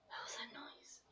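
hushed spooked whisper. Real, not acted. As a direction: whisper it, listening for the noise. Spooked by a noise in game.

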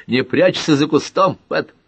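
cross, loud order; angry call. A narrator's reading. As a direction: call out accusingly, voice pushed up. Jealous Carter confronts Pat.

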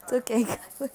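jolted, telling everyone all is fine; startled reassurance. Real, not acted. Startled speaker reassures viewers after bag falls, loud and high.

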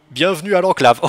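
loud, hammed-up robot greeting; a botched robot announcement, too loud. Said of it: "une meilleure voix de robot que ça".

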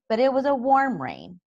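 usual speaking voice

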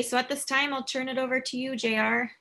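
ordinary delivery

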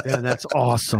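ordinary talk

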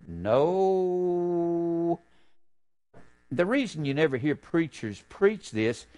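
ordinary talk

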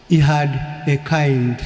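ordinary talk, nothing marked

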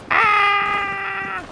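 a sudden very high, loud yelp; sudden scream. Real, not acted. Startled scream at his teammate during the fight. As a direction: scream as you get caught off guard.

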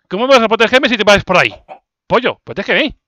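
loud, fast, high complaint. Real, not acted. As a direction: scold the pet loudly. Complaining to chicken.

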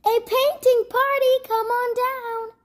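high, loud, cheery call to the whole town; excited invitation. Acted. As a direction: throw the doors open and call everyone in. Diplo invites everyone to painting party.

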